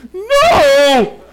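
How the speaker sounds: high loud cry of no; a frightened kid yelling no; scared refusal. Retold or imitated. Son refuses to come near the coon, shouting.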